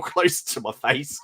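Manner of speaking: normal talking voice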